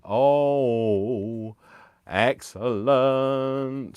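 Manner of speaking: loud, drawn-out excellent; delighted exclamation. Real, not acted. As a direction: stretch excellent out with glee. Delighted at a viewer's gift.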